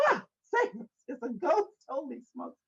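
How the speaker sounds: high hammed-up fright; mock fear. Preacher acting the disciples' fear, hammed-up fright. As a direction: play the scared disciples.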